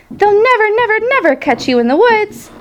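high, insistent goose call; shouting. A narrator's reading. Goose spurs the pig toward the woods, shouting high. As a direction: holler it high, without much extra volume. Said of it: "she shouted to Wilbur".

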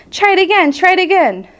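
high, cheering encouragement; crying out. A narrator's reading. Goose encourages the dodging pig, crying out high. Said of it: "cried the goose".

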